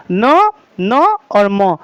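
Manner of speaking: teacher sounding out nasal letters slowly; nasal letters demo. Shown as a demonstration. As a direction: hum न, न and म slowly through the nose. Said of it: "नासिक यानी नाक की सहायता".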